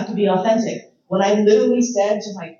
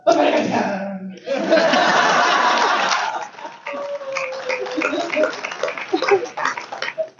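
long laughter; 9 semitones higher than usual. Real, not acted.

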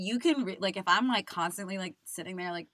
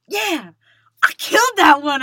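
loud, high, triumphant shout; excited boast; 9 semitones higher, 16 dB louder than usual. Retold or imitated. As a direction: crow about nailing it. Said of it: "and I was like".

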